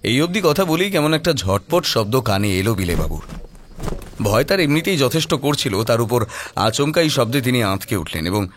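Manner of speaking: plain reading voice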